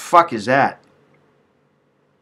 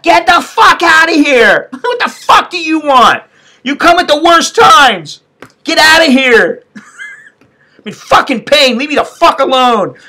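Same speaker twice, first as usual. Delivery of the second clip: high, loud, drawn-out angry yell; re-enacted yelling; 12 semitones higher, 4 dB louder, slower than usual. Patient in pain yelling at billing lady, re-enacted yell. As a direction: yell them out of the room, stretching the words. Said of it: "when I was yelling at her".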